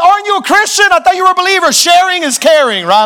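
shaming him into sharing; mock indignant appeal. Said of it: "And you're just like".

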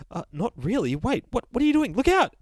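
a fussy narrator losing his nerve, yelping; panicked narrator. Acted. Narrator warns the bear as he jumps, rising panic.

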